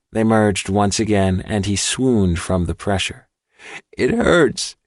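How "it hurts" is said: high, pained cry. A narrator's reading. Boy hurting from the merging, high pained cry. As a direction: yelp it as the pressure hurts. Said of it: "he cried".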